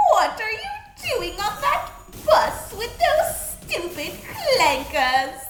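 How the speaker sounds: high, slow vaguely British villainess; Cromer impression. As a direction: draw out each word in theatrical outrage. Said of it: "My English Cromer impression".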